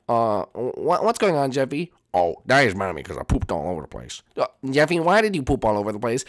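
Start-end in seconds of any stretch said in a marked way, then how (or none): 2.1-4.2 put-on low whiny kid voice; Jeffy's low put-on whine. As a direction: whine like a naughty child. Jeffy character whining.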